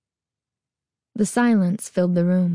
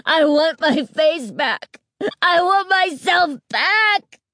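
high, loud, slow scream; 9 semitones higher, 3 dB louder, slower than usual. A narrator's reading. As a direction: scream at the top of your lungs. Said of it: "She was screaming at the top of her lungs".

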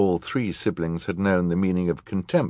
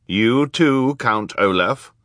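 raised, firm command; 5 semitones higher, 5 dB louder, slower than usual. A narrator's reading. Judge ordering the villain to stand, firm. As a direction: order him up firmly. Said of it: "Justice Strauss said firmly".

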